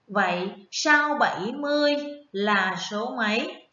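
ordinary talk, nothing marked

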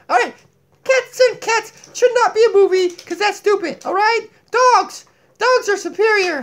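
high, lively puppet voice; Donkey puppet voice. Acted. Puppet Donkey lecturing viewers about cats, high puppet voice.

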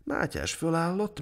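ordinary delivery, nothing marked

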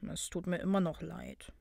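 low, soft apology; quiet and abashed; embarrassed murmur. A narrator's reading. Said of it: "murmelte dieser verlegen".

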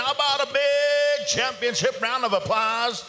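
booming high arena announcer call; powwow emcee. Acted. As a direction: belt it over the PA like an emcee. PA announcer calling the dance.

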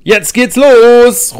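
loud, rallying cheer; rally the team off on adventure; excited call. A narrator's reading. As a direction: Steffi cheering the group onward. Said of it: "ruft Steffi".

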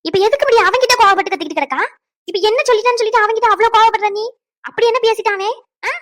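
cross, high-pitched scolding; indignant challenge. Acted. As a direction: leap angrily to his defence. Defends man in acted quarrel.

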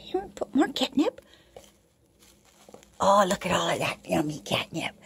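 soft unvoiced aside; hushed little whisper. Real, not acted. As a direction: whisper so the cats are not disturbed. Aside while cats play.

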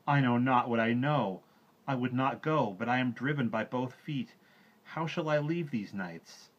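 mild sobbing plea. A narrator's reading. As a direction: plead like a boy near tears. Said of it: "throwing up his arms with a sob".

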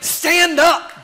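loud, high preacher's shout; full-voiced, high rallying cry. Real, not acted. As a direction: shout it as a command to rise. Rallying the congregation to stand.

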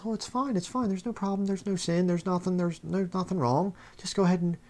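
high, hushed mock reassurance; mock complacent. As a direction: lampoon the easygoing preacher, airy and soft. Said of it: "sits there and says".

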